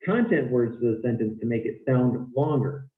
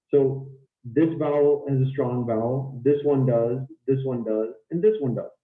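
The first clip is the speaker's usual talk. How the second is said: normal talking voice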